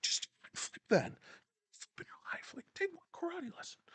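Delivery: strained whisper breaking into high squeaks; mock exasperation, squeezed thin and very soft; Mac. As Mac demanding a karate lesson, exasperated. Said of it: "It's like Mac and, like, It's Always Sunny".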